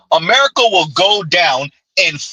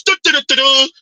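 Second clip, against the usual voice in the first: higher singsong du-du-du; mock fingers-in-ears chant; 6 semitones higher than usual. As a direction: hum over them like a child not listening. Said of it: "fingers back in your ears".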